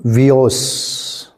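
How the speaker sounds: वियोस stretched very slowly; slow teacher model; slow demo. Shown as a demonstration. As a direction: draw out वियोस like a drill.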